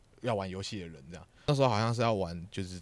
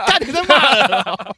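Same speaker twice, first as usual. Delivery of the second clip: high, loud, slow laughing accusation; laughing protest; 13 semitones higher, 16 dB louder, slower than usual. Real, not acted.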